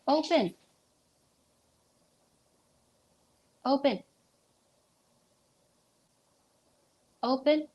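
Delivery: high, insistent one-word order; dog command. Real, not acted. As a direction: make the dog drop it. Ordering dog to open mouth.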